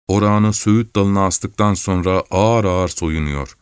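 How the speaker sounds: plain reading voice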